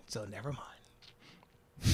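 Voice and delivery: hushed, unvoiced take-back; fading whisper, slowing on never mind; whispered aside. Real, not acted. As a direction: whisper it like you shouldn't say it. Backing off a comment.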